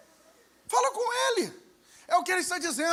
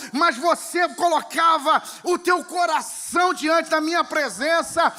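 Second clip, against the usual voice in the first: loud, passionate preacher voicing God; preaching at full force; 2 semitones higher, 7 dB louder than usual. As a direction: proclaim God's longing, full voice. Voicing God's words, preaching. (pregação apaixonada em voz alta)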